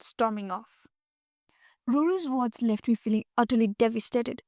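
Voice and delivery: plain reading voice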